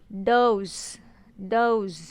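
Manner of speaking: "डोज" repeated with a buzzed final z; buzzing z ending. Shown as a demonstration. Teacher repeating the example, "डोज" ending in buzzed z. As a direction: let each "डोज" close on a z. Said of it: "ज़ेड का buzzing sound आ रहा है".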